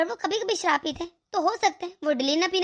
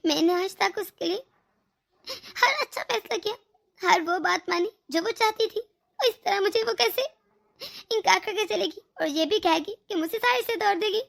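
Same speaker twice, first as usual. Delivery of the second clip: ordinary talk, nothing marked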